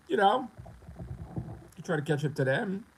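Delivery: ordinary talk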